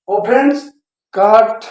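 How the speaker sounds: normal talking voice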